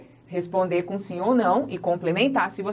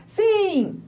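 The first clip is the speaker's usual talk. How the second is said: mock wrong answer; 12 semitones higher than usual. Shown as a demonstration. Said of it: "não tem como você falar".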